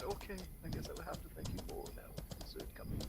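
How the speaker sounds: hushed weary aside; whispered aside. Retold or imitated. Guest grumbling aside about thanking again for dessert, whispered. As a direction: mutter it under your breath.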